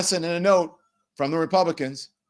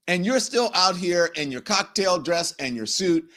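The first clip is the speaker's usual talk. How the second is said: ordinary delivery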